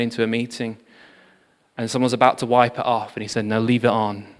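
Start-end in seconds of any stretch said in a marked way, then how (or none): none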